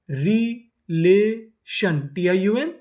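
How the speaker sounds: Relation very slow as रि, ले, शन; syllable by syllable. Shown as a demonstration. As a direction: sound out relation with gaps between syllables. Teacher sounding out new word.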